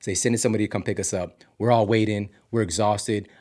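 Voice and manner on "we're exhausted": ordinary talk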